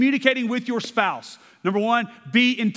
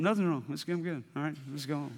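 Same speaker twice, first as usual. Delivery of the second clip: mock dismissive mumble; 5 semitones lower, 10 dB quieter than usual. Man brushing off his wife's question.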